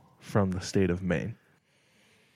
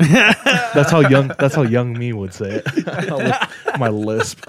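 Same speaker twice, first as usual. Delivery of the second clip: laughing; 3 semitones higher, 6 dB louder, slower than usual. Real, not acted. Laughing at childhood self.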